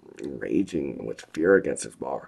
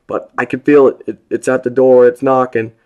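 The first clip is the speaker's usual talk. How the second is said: frightened call; 11 semitones higher, 18 dB louder than usual. A narrator's reading. Prisoner reports the thing at door.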